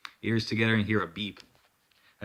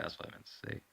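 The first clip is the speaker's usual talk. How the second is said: whispering; 15 dB quieter, faster than usual. Real, not acted. Aside correcting himself.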